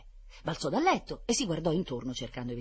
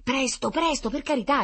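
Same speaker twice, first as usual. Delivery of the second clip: high, louder imploring; impatient pleading; 12 semitones higher, 6 dB louder than usual. A narrator's reading. Children imploring Lucrezia at the phone, impatient. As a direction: beg them urgently to hurry. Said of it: "imploravano i bambini impazienti".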